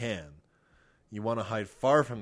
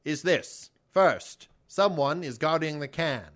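put-on loud old-timey announcer, pitched up; 4 semitones higher, 4 dB louder than usual. Explaining kick the can to Kevin on request, mock old-timey voice. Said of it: "explain to me kick the can in an old-timey voice".

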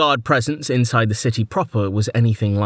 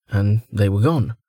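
ordinary delivery

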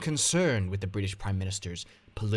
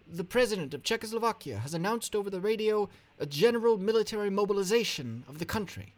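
urgent announcement; 12 semitones higher, faster than usual. Acted. Translator reads urgent message.

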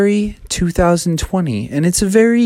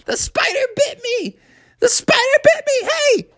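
very high, wailing cry of pain; crying out bitten. Acted. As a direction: squeal in panic after the bite. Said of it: "He went".

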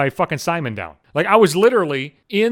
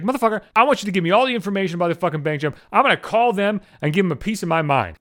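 ordinary talk

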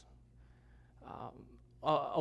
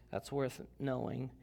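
normal talking voice

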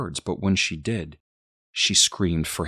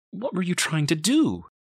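high, distressed question; horrified questioning; 13 semitones higher than usual. A narrator's reading. Mother confronts baby, high alarmed voice. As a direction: ask it pitched up in alarm.

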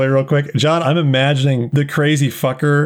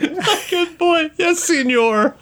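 much higher, slow, laughing mimicry; mock mimicry; slower than usual. Game master parroting the dealer's phrase, high and slow. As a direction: pitch it way up and stretch it. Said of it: "trying to assimilate their, like, the way they talk".

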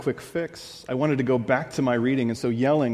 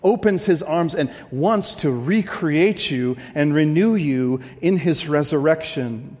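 loud, swelling pulpit delivery; full-force preaching; 4 semitones higher, 6 dB louder than usual. Acted. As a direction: preach it big and slow to the crowd.

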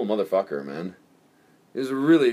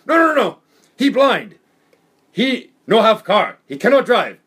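mock broken English; 8 semitones higher, 7 dB louder than usual. Imitating Japanese girlfriend's English.

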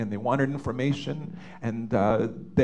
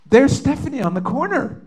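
much higher and louder, sudden recognition; urgent exclamation; 12 semitones higher, 7 dB louder than usual. Retold or imitated. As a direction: blurt it out like you just spotted her. Spotting lost daughter on street.

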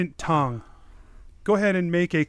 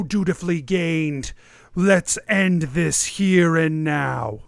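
slow, louder villain menace; slow theatrical villain menace; 3 dB louder than usual. Acted. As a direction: threaten them slowly like a dark lord. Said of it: "he says".

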